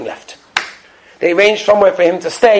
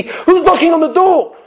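startled; 11 semitones higher than usual. Retold or imitated. Scared students in the bunker.

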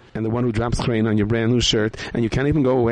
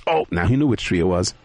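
ordinary delivery, nothing marked

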